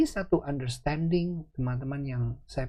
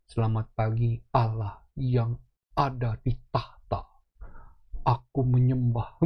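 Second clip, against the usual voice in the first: mock formal prayer; 5 semitones lower than usual. Hamba praying to Allah in tahta, mock solemn prayer. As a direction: pray haltingly like a distant servant, hammed up.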